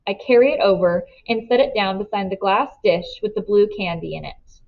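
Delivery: ordinary delivery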